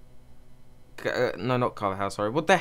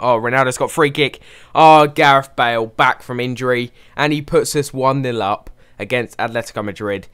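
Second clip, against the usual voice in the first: excited goal shout; 3 semitones higher, 9 dB louder than usual. Real, not acted. Goal scored in his match.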